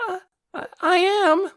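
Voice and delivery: put-on high, tearful boy voice; timid whimper. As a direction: answer on the verge of tears. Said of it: "He trembled as if expecting to be chewed out".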